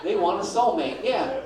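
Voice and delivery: ordinary talk, nothing marked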